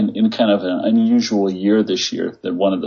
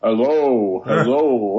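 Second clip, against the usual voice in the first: booming stadium announcer hello; 3 semitones higher, much slower than usual. Retold or imitated. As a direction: boom it out like an arena PA. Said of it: "it sounded like the P A announcer saying".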